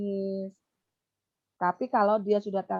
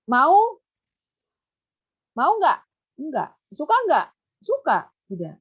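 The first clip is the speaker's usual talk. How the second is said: high, loud questions to a pupil; teacher questioning a pupil about food; asking a child; 5 semitones higher, 7 dB louder than usual. Shown as a demonstration. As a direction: quiz the child simply. Demo question and answer with child.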